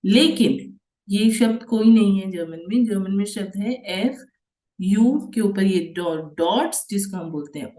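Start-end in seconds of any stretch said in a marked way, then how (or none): none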